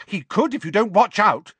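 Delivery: put-on high, loud indignant girl; angry. A narrator's reading. Girl warning the teasing twins, angrily. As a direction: snap back crossly, pitched right up. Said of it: "said Hermione angrily".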